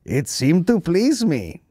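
slow, high put-on Korean accent; drawn-out, swooping Korean-accented parody; mock Kim accent. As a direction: play the pleased leader in mock Korean accent. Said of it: "And he's like".

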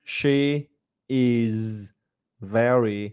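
"She is very beautiful" with pauses, "very" slow; teacher demo; slow, word by word. Shown as a demonstration. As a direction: practice sentence spaced out. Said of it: "Tập nhá".

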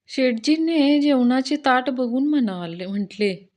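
ordinary talk, nothing marked

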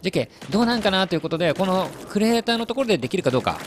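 usual speaking voice

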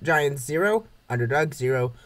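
ordinary delivery, nothing marked

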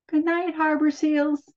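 high squealing goodnight. Acted. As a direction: squeal it out brightly. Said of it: "she squealed".

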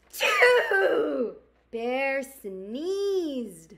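gentle storybook narration; reading to children. Acted. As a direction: read it like storytime for kids. Reads picture book aloud.

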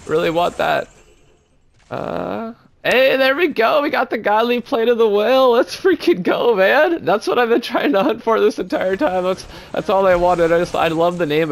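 thrilled shout over a loot drop; excited. Real, not acted. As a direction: cheer it, high and loud.

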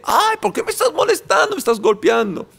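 high-pitched imitation of a pestered sleeper; mock whining sleeper. Preacher playing the sleeper protesting at being shaken, mock whine. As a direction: complain shrilly at being woken, hammed-up.

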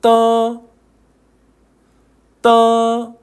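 떠 held long, tense t; sustained level 떠 with a hard ㄸ onset; pronunciation drill. Shown as a demonstration. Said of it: "한 번씩 읽어볼까요?".

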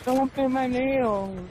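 whiny crybaby impression. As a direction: squeal it like a spoiled brat throwing a tantrum. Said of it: "I whine and bitch and complain".

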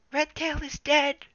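put-on very high, slow strained young cat cry; breathless declaration. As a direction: gasp out the news. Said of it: "summoned enough breath to declare".

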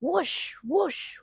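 ordinary delivery

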